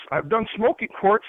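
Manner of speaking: ordinary delivery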